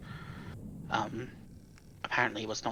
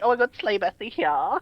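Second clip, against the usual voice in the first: a touch higher, sassy queen; ironic zoomer; 7 semitones higher than usual. Pretend. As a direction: gush like a slang-loving queen. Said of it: "Queen will be like".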